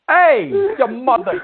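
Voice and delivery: high, drawn-out yell of "Hey, your mother"; hammed-up outraged comeback; mock outraged yell. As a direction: fire the insult straight back, playing up the outrage. Host retorts to a mother insult.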